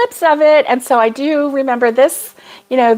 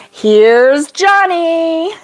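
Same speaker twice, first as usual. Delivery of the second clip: high, drawn-out sing-song movie catchphrase; playful, drawling film-villain taunt; Shining movie impression; 7 semitones higher, much slower than usual. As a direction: stretch it out, climbing high at the end. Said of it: "that was The Shining".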